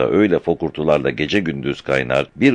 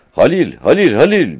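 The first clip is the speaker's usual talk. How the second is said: high, loud call of a name; shouting; 9 semitones higher, 6 dB louder than usual. A narrator's reading. Mehmet wakes his companion about the dried-up water, shouting. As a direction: cry out urgently to someone out of earshot. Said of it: "diye bağırdı".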